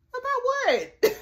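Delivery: high, drawn-out disbelief; startled question. Acted. Sarah answers father-in-law's request to talk, startled. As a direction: stretch it out high, taken aback.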